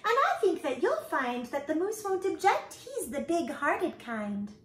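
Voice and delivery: high-pitched, merry bug voice; laughing bug. A narrator's reading. Bug reassures the spider about the moose, merry. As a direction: chatter on high and jolly. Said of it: "laughed the bug".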